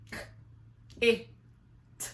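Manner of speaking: K, I, t each sound said apart; sounding out letters. Shown as a demonstration. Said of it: "Let's do it again".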